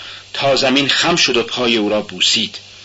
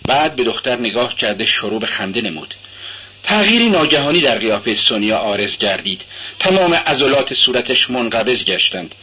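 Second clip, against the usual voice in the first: plain reading voice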